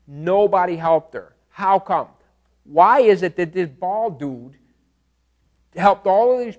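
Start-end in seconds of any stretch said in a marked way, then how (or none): none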